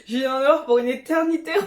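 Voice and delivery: giggling at how big the jar is; laughing while talking. Real, not acted. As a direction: laugh through the line about the huge pot. Laughing at huge pot.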